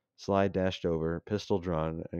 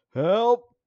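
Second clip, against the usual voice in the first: much higher, loud desperate scream; yelled for help; 13 dB louder than usual. Acted. Mac on the ground calling for help, desperate scream. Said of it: "yelled Mac".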